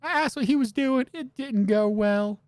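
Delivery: put-on high generic girl voice. Wife answering the detective, in a high girl voice. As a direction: pitch it up as a plain young woman. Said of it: "I am just generic girl voice".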